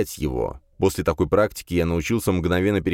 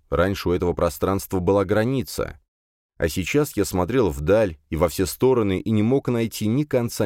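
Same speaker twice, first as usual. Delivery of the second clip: usual speaking voice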